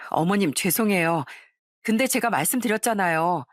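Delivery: ordinary delivery, nothing marked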